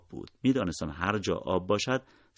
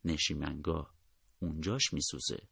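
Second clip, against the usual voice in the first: soft, discreet undertone; quietly; 3 semitones lower, 7 dB quieter than usual. A narrator's reading. Narrator tells the nurse where his friend hurts, quietly. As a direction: drop the voice to pass it on discreetly. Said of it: "آهسته گفتم".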